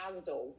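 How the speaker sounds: Asdal with an L on the end, slowly; Bristol-style Asdal; local pronunciation. Shown as a demonstration. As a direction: say Asdal, tacking an L onto Asda. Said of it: "here they say Asdal".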